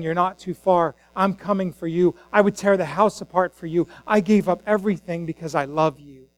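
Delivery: hushed, heartfelt comfort; tender reassurance. Acted. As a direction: reassure someone who feels lost, gently. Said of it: "Jesus is saying".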